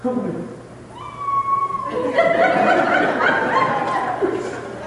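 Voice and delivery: long, very high wail; mock sobbing howl; imitated bawling. Wife falling into husband's arms, imitated long high bawl. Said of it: "She bawled and fell in my arms".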